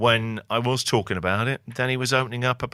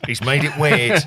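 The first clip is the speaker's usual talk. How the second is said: a louder mock cry of a mate who finds it awkward; mock horrified mate; 5 semitones higher, 7 dB louder than usual. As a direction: ham up the mate's horror, loudly. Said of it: "they're gonna go".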